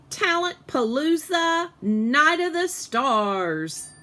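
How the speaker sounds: slow, high, showy announcer voice; teacher hamming up the show title; grand announcement. Acted. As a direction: present it like a marquee.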